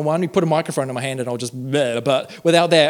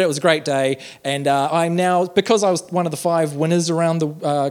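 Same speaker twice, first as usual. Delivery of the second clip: usual speaking voice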